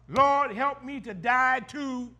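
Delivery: slow, weighty plea to the Lord; prayer refrain. Acted. Preacher leading the congregation in prayer, slow plea. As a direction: pray it slowly, meaning every word.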